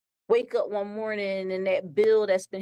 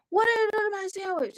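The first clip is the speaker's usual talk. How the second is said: much higher, fast squeaky demand; a cartoon customer squawking in alarm; outraged dude; 11 semitones higher, faster than usual. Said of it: "the dude was like".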